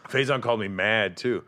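ordinary talk

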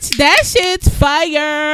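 loud, slow hype; excited praise. Real, not acted. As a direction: drag it out excitedly. Hyping friend's website.

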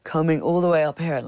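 ordinary delivery

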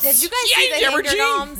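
silly high voice. Pretend. Joke voice continues.

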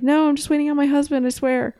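fast, high defensive protest; quick high-pitched pleading; imitating herself. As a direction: rush the excuse out, pitched high. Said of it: "and I'm like".

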